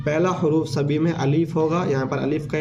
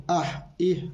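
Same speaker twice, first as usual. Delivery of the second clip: आह इह ऊ said slowly, each vowel clear; slow syllable demo; 2 semitones higher, slower than usual. Shown as a demonstration. Teacher showing the vowel sounds, slow. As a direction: slowly model आह इह ऊ. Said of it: "ऐसे भी आप इसको पढ़ सकते हैं".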